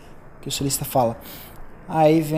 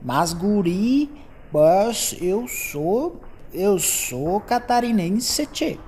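slow, drawn-out mock southern Brazilian accent, higher in pitch; a slow, higher teasing imitation of a southern Brazilian accent; Santa Catarina accent; 5 semitones higher, much slower than usual. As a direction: ham up the Santa Catarina accent and stretch every word. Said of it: "Fala assim".

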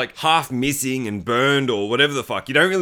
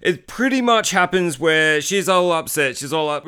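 ordinary talk, nothing marked

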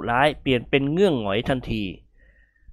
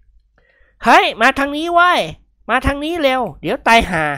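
high, loud call to a friend; worried shout; 11 semitones higher, 4 dB louder, slower than usual. A narrator's reading. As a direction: yell him over before he's gored. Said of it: "ร้องตะโกนเรียก". (กิมหงวนตะโกนเรียกเพื่อนด้วยความเป็นห่วง)

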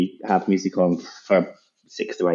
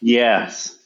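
normal talking voice

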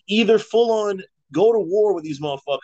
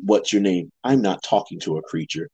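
deep mock voice; 7 semitones lower than usual.

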